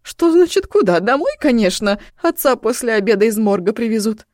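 high loud indignant woman's voice; mother snaps back, exasperated. Acted.

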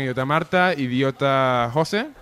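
ordinary delivery

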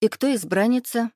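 high, lilting question; curious. A narrator's reading. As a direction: ask with lively interest. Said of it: "с любопытством поинтересовалась муза".

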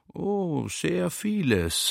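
slow, stretched-out words; a slow, lingering reply; drawn out. Retold or imitated. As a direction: stretch each word lazily. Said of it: "sage ich gedehnt".

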